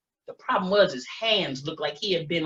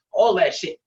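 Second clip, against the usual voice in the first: normal talking voice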